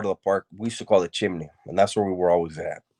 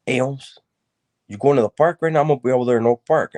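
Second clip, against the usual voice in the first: higher, louder retold hail to a buddy; eager homie greeting; 6 semitones higher, 5 dB louder than usual. Homeboy at his door asking about the park, eager. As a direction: imitate a friend eagerly calling out plans.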